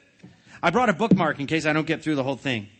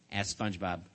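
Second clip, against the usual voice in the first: ordinary delivery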